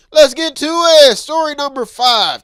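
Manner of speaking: loud, high slow segment intro; hype announcer. Acted. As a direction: announce it like a game show.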